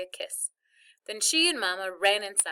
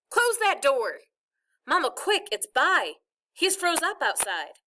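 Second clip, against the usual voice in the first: urgent raised higher voice; yelled; 7 semitones higher, 4 dB louder than usual. A narrator's reading. Child bursting indoors to get help for brother, yelled. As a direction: cry it out in alarm. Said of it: "Dad, and Joey all yelled".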